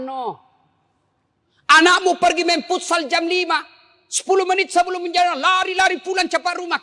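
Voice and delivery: high, loud, urgent sermon delivery; fervent preaching. Acted. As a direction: preach it with rising fire. Preacher rising into fervour. (ceramah penuh semangat, suara tinggi dan keras)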